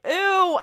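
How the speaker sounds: high, loud drawn-out ew of disgust; mock grossed-out squeal. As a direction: squeal ew in exaggerated revulsion. Viewer's disgust at the creepy ghost.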